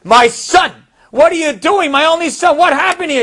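high, loud outraged cry; a furious father bursting in; enraged father. Retold or imitated. Father finding son with Shabbat candles, enraged loud outcry. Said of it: "The father gets enraged".

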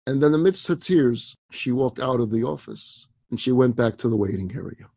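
usual speaking voice

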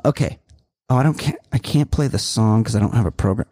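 ordinary delivery

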